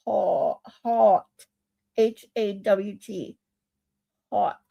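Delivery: "hot" drawn out slowly, vowel stretched like "hawt"; slow down and stretch each "hot"; slowed careful "hot". Shown as a demonstration. Struggling to say "hot" for chat, slowly. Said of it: "slow down to say it".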